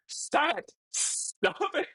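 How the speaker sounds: very high squeal thinning to a squeak; shrieky high plea to stop; overwhelmed protest. Real, not acted. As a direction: squeal it helplessly at your friends. More donations arrive, begging chat.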